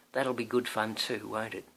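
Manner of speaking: usual speaking voice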